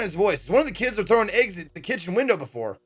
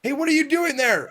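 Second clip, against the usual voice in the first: calling out; 9 semitones higher than usual. Acted. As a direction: call out sharply to catch them. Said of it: "A new voice interrupts".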